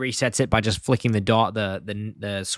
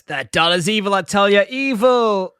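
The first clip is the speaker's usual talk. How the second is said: lightly acted old man's warning, higher; Grandpa; 7 semitones higher, 4 dB louder than usual. Grandpa warns the family about the doll, mock ominous. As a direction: raise the pitch toward the final "evil". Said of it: "Grandpa says".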